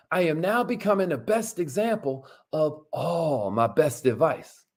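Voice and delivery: mean every word of it; affirmation reading. Shown as a demonstration. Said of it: "Say it like you mean it".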